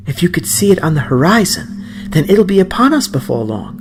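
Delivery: put-on an excited old woman hurrying the boy along; eager grandmother.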